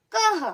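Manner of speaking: कह ending on a half ह; visarga breath. Shown as a demonstration. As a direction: snap from क into a breathy h in कह. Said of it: "तो कैसे बोलेंगे".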